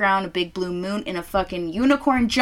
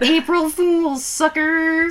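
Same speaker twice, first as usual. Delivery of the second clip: taunting; 8 semitones higher, 4 dB louder, slower than usual. Acted. Taunting after prank.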